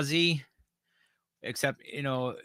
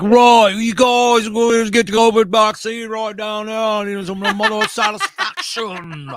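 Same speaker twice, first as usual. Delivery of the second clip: hammed-up frontman greeting the crowd; 8 semitones higher, 10 dB louder than usual. Mocking the singer promoting the vaccine, high loud laughing impression. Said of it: "[name]'s like".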